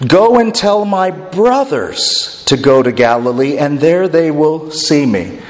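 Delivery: slow, leaning on "my brothers"; preacher stressing "my brothers" in the quote. Shown as a demonstration. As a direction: press "my brothers" slowly and firmly. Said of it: "I emphasized it".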